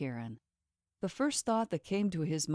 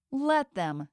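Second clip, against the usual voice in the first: higher, bitter ranting repeat; 7 semitones higher than usual. A narrator's reading. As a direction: spit it back while ranting. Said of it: "she said as she continued to rant".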